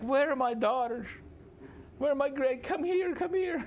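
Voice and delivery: very high, tearful mock wail; mock crying plea. Laban calls for his daughters and grandchildren, mock crying plea. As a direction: play a weepy father calling his children over. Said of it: "You can just picture him crying".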